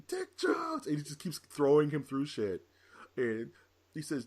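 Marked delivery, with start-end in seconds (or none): 0.1-0.9 high squealed name; mock villain plea. Retelling Boddicker pleading to RoboCop, high mock squeal. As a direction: squeak the boss's name in panic. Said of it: "He was like".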